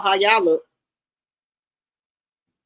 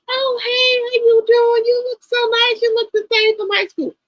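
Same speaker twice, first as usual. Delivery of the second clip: high fake-sweet greeting, much higher; mocking old classmates. Mocking the old school bullies who now greet her sweetly. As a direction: mimic the phony friendly girls from high school. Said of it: "I see these girls right now".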